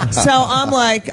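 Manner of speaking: ordinary talk, nothing marked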